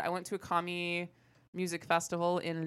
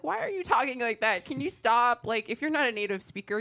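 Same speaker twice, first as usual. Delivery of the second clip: mildly exasperated, pitched-up pleading; exasperated retold complaint; 7 semitones higher, 5 dB louder than usual. Acting out her reaction to slang talk, mock exasperation. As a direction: complain as if fed up, louder and higher.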